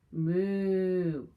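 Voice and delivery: a loud, drawn-out mock moo; playful cow lowing. Cows typing refrain.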